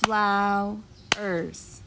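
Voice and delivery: "Flowers" slowly as Flow, ers; two separate beats in "Flowers", gap between; split into syllables. Shown as a demonstration. Teacher asks how many syllables, "Flowers" split in two. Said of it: "Let's clap the syllables together".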